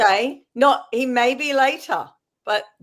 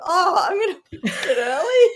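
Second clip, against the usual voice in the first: giggling through the words; laughing; 10 semitones higher, 3 dB louder than usual. Retold or imitated. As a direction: laugh while saying it. Retelling own thought, laughing.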